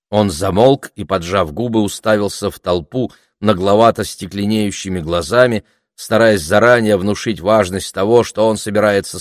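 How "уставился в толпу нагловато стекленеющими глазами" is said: ordinary delivery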